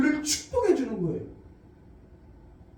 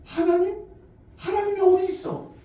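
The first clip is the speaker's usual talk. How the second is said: raised, taunting question; mock the believer's faith; scoffing unbeliever, pitched up; mocking scoffers; 6 semitones higher than usual. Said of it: "사람들이 우리에게 그러죠".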